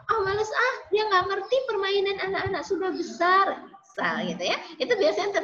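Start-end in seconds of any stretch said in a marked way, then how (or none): 0.1-3.6 put-on higher, bored brush-off of a younger kid; mock a playmate who can't keep up, pitched up; imitating a child saying they can't be bothered; dismissive classmate.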